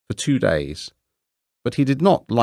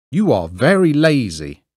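lightly voiced scolding miller; 6 semitones higher, 5 dB louder than usual. A narrator's reading. Miller lecturing Hans.

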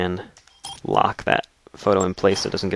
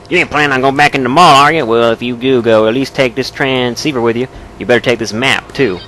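put-on southern accent; 4 semitones higher, 4 dB louder than usual. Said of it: "why he has a southern accent".

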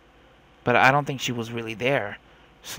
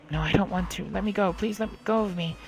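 higher, distressed begging; frightened pleading; 4 semitones higher than usual. Acted. Child begs her captor to release her, higher frightened pleading. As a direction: plead like a child trying to break free.

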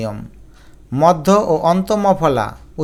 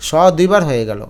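ordinary talk, nothing marked

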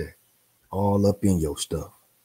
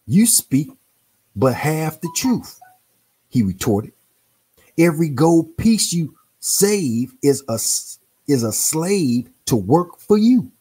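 higher and slow, a lecturing old man lightly voiced; stern retort read out; 7 semitones higher, 4 dB louder than usual. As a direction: read the rebuke slowly, lifting the pitch. Reads the retort from book.

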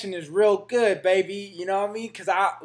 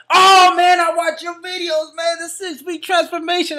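much higher fan voice; gushing fan; 9 semitones higher, 6 dB louder than usual. Retold or imitated. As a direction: gush like a starstruck kid. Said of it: "And he was like".

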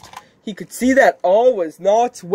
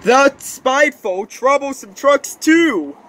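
higher-pitched, emphatic grumble; grumbling complaint; 9 semitones higher, 4 dB louder than usual. Acted. Engine grumbling about spiteful trucks, emphatic complaint. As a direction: complain with rising indignation.

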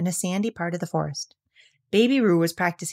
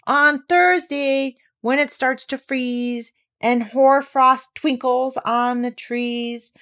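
slow, measured verse with lifted line openings; ponderous storybook poetry reading; poem recitation; 4 semitones higher, slower than usual. A narrator's reading. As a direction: recite it deliberately, drawing out the rhymes.

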